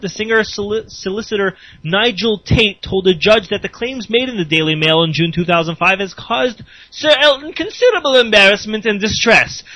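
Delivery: ordinary talk, nothing marked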